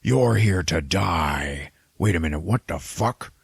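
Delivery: slow monster menace turning baffled; rough demon voice, doom giving way to confusion; Dormammu. Retold or imitated. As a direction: giant demon losing the thread. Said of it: "And he's like".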